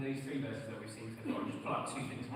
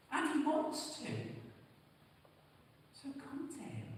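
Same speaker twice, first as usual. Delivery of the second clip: higher, urgent, pressing appeal; impassioned preaching; 8 semitones higher than usual. Acted. Preacher urging listeners to come to Jesus, impassioned. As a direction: plead with them to come to Jesus.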